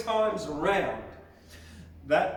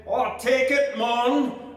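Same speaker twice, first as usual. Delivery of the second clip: high, loud Scottish reply, I'll take it, mon; Scottish accent; 6 semitones higher, 5 dB louder than usual. A narrator's reading. McDougall answers the barracker in a loud, high Scottish accent. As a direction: accept the bet with a canny Scottish lilt, loud and high. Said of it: "McDougall was a Scotchman".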